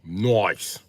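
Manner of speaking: "Nice" as "noice" in a mock British accent, loud. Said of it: "The accent is perfection".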